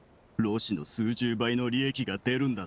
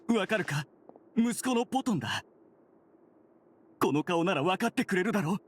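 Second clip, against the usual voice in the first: urgent pleading; 5 semitones higher than usual. Acted. Son pleads with unresponsive mother.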